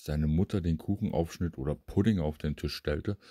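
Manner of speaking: usual speaking voice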